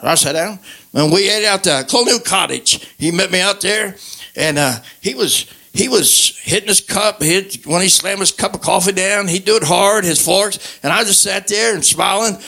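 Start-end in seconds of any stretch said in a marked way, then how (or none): none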